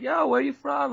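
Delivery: high, laid-back traveller voice; chill guest. Retold or imitated. Hostel guest greeting the unknown owner, a high, laid-back traveller voice. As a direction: play a relaxed backpacker greeting a newcomer.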